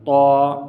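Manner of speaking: تو said loud, heavy and full; emphatic, thickened تو; thick letter. Shown as a demonstration. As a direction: fill the mouth on a long تو. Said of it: "تو موٹا کریں".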